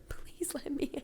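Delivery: soft pleading whisper; breathy unvoiced please, mock pleading. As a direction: whisper it, begging to be let in. Retold pleading at the door.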